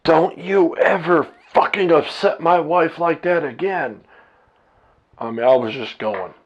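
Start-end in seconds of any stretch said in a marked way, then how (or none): 0.0-4.0 angry shout, higher and louder; a father yelling at the landlord, furious; retold screaming. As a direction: bark it at the landlord as a threat. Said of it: "I started fucking screaming at her".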